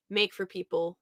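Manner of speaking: ordinary talk, nothing marked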